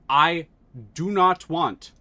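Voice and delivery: usual speaking voice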